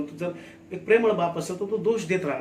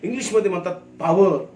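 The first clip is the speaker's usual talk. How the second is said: raised, emphatic sermon delivery; forceful preaching; 3 semitones higher, 5 dB louder than usual. Real, not acted. Preacher tells the congregation of the Holy Spirit's power, forcefully. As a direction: drive each key word home loudly.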